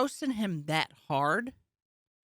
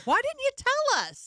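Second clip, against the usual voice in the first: much higher squealed fake indignation; mock outrage. Comic repeating the bit to the drunk fan, squealing mock outrage. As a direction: overplay the betrayal for laughs.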